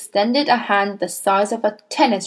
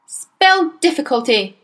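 higher, louder headmistress bark; the Trunchbull; 6 semitones higher, 4 dB louder than usual. A narrator's reading. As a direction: point and bark at the girl. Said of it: "she said".

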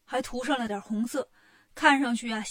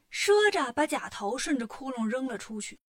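ordinary delivery

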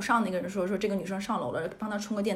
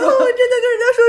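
laughing; 14 semitones higher, 13 dB louder, faster than usual. Real, not acted. Laughing at own story.

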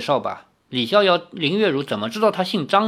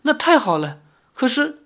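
high-pitched relief, then hesitation; relieved old man; 10 semitones higher than usual. A narrator's reading. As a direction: brighten with relief, then falter. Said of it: "老头儿这才完全如释重负". (如释重负地高声说)

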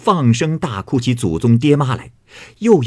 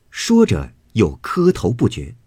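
ordinary delivery

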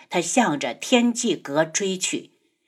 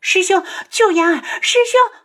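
put-on high, frantic girlish shrieks; screaming; 10 semitones higher, 4 dB louder than usual. A narrator's reading. 嫣儿 begs her senior brother to save her, screaming. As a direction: shriek for help, climbing higher. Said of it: "嫣儿的尖叫声又响了起来".

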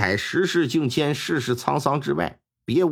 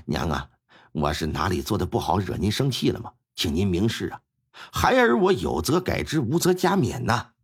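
humble son asking what he did wrong; dutiful son pleading; 7 semitones lower than usual. Acted. Son begs his mother to name his fault, low and humble.

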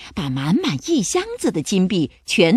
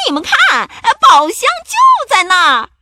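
very high, loud pirate cry; excited shout; 13 semitones higher, 9 dB louder than usual. A narrator's reading. Pirate spotting the treasure chest, shouting out high. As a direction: call the crew over at the top of your voice. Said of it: "花衣裳佩格大叫起来".

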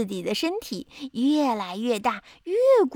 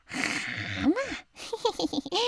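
sly snake; 4 semitones higher, 5 dB quieter than usual. Acted. Hungry snake threatens.

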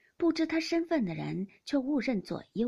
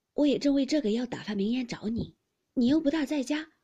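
louder, pitched-up mild reproach; eager young gentleman; 4 semitones higher, 7 dB louder than usual. A narrator's reading. Baoyu chiding his friend for never being home, eager and raised. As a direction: lift the voice, eager and a touch put out.

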